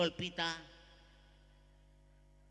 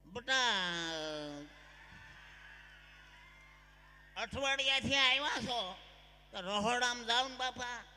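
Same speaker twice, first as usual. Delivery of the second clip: louder mother-in-law scolding; 4 dB louder than usual. Acted. Mother-in-law yells at the new bride to go cook. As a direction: shout at the bride to get to the kitchen.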